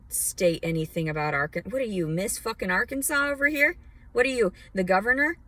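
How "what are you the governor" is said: ordinary delivery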